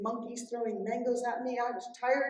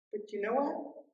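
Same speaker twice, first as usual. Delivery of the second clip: ordinary delivery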